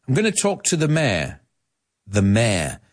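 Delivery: "mayor" squeezed into one syllable, meh; British pronunciation. Shown as a demonstration. Teacher using it in a sentence, "mayor" the British way, one syllable. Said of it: "The British say mayor".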